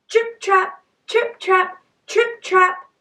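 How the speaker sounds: high, rhythmic trip-trap; hoofstep sound effect. A narrator's reading. Goat's hooves crossing the bridge.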